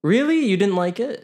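high, loud disbelieving "really"; surprised. Real, not acted. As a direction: caught off guard. Shocked at middle-of-road verdict.

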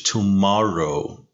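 "Tomorrow" drawn out, weight on "mor"; stress on second syllable. Shown as a demonstration. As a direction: slowly model "Tomorrow" with the middle syllable stressed. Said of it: "تاکید روی سیلاب دوم لغت هستش". («Tomorrow» کشیده، با تأکید روی سیلاب دوم)

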